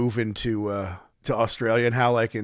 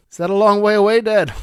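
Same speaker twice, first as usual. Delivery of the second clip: put-on higher, louder little-boy voice; imitated young son; 7 semitones higher, 6 dB louder than usual. Son asks Dad about moving to Australia, imitated young son. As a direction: ask Dad in a pitched-up child's voice. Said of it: "All right, son".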